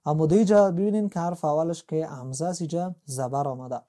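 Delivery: ordinary delivery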